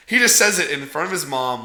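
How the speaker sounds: ordinary talk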